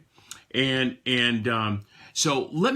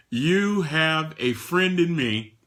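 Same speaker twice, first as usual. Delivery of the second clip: normal talking voice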